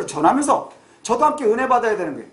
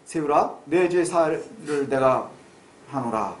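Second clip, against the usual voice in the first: lowered, slow, solemn pronouncement voice; mock priestly absolution; 8 semitones lower, 4 dB quieter, slower than usual. Pastor hams up absolving a congregant, solemn and slow. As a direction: intone it like a mock priest forgiving sins. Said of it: "그럼 제가 이단이 되는 거예요".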